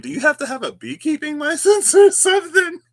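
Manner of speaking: high, loud question breaking into laughter; laughing. Real, not acted. Host asking about beekeeping licenses, laughing. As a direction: crack up while asking.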